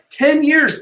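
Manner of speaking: "years" lifted high and pushed hard; emphatic repeat. Real, not acted. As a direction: hammer home "Ten years". Repeating the key statistic.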